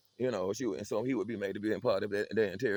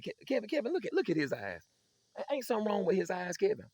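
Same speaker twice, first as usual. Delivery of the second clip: high, loud summons to the brother; pulling the other son in as a witness; mama calling for backup; 11 semitones higher, 4 dB louder than usual. Retold or imitated.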